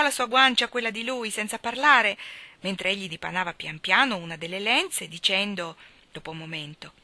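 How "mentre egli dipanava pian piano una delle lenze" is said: ordinary delivery, nothing marked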